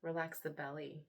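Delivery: soft, unhurried meditation voice with long pauses; guided meditation. Acted. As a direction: hush down and gently lead them into relaxation. Leads a guided relaxation.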